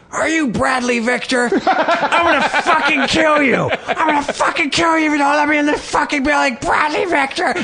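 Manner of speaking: high, laughing mock shriek of death threats. Homeless man shrieking threats at the neighbor's window. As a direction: scream it at the top of your lungs. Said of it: "Starts screaming my name at the top of his lungs".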